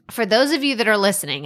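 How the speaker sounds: normal talking voice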